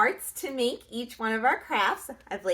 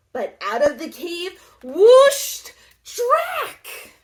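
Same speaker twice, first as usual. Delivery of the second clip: slow, higher, louder buildup; dramatic reveal; 6 semitones higher, 9 dB louder, slower than usual. A narrator's reading. Narrator reveals Drac slowly, louder. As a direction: build it up for the big entrance.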